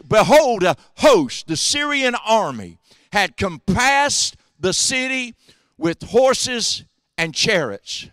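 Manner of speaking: high, slow, solemn Bible reading; scripture reading. Acted. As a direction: read the verse out with weight.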